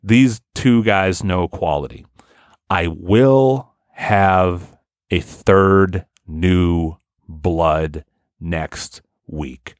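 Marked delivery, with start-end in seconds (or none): 2.7-9.8 slow, word-by-word reveal; slow announcement. Real, not acted. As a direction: space out each word to build suspense. Drawn-out announcement of next week's guest.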